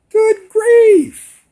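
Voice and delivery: very high, loud cry of disbelief; shrill, over-the-top exasperation; exasperated outcry. Retold or imitated. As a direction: wail it out in mock despair. Reacting to the threatened whooping.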